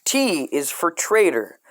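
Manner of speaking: usual speaking voice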